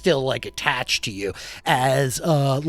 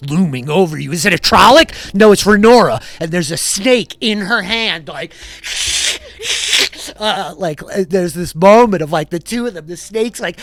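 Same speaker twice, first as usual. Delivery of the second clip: raised, loud, urgent storytelling; heightened game master narration of an attack; dramatic action narration; 4 semitones higher, 6 dB louder than usual. Acted. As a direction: build the danger with higher, punched-up phrases.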